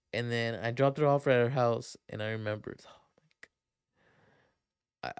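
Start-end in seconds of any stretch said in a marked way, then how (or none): 2.9-5.1 breathless whispered oh my God; barely voiced dawning dread; whispered panic; whispered realization. Real, not acted. Sudden realization.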